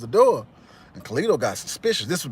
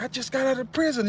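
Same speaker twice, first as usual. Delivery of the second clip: high, exasperated retelling; imitating Carlito; 12 semitones higher, 4 dB quieter than usual. Carlito answering his cousin, exasperated mock voice. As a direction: protest like a man just freed. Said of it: "Carlito was like".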